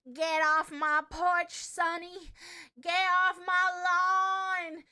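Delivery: put-on slow, loud grumpy old woman yelling at kids; cranky old lady. Streamer imitating a cranky old lady, slow and loud. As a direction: drawl it out like a crabby granny on her porch. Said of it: "I wanna be a cranky old lady".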